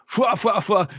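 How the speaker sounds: high puffing "fwa" laugh; imitated copycat cartoon laugh; crew's silly laugh. Host as the crew continuing the mimicry, high mock laugh. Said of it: "the crew continues".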